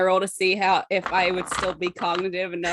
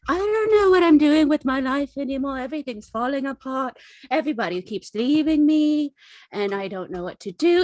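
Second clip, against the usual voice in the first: loud, high mock-distraught outpouring; despairing lament; 6 semitones higher, 3 dB louder than usual. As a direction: wail it like an overwrought retelling. Character retells own despairing lament.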